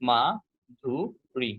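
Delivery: মাধুরী very slowly in three beats, মা, ধু, রী; split into syllables. Shown as a demonstration. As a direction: pause after each syllable of মাধুরী. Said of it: "আমরা যদি উচ্চারণ করি".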